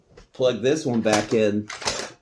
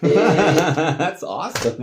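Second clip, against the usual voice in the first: slow words through real laughter; amused, higher and louder, laughing through it; 7 semitones higher, 7 dB louder, much slower than usual. Real, not acted. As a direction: laugh while you say it, delighted. Laughing at the old recording.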